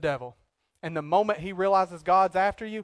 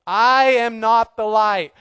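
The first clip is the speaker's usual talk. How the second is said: I am not the light, slow and much louder; louder, preaching; 3 semitones higher, 8 dB louder, slower than usual. Shown as a demonstration. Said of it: "get this through their head".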